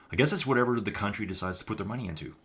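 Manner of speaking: usual speaking voice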